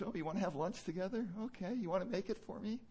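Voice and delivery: breezy, offhand chat with the wife; casual husband. Retold or imitated. Husband asking wife about lunch, breezy and offhand. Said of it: "waking up in the morning going".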